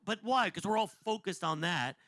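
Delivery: ordinary delivery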